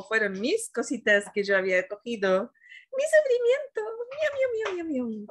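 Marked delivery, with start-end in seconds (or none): 3.0-5.3 high, whiny cat meows; mock meowing. Mocking her own old suffering, a high kitten whine. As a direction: whimper like a little kitten, self-mocking.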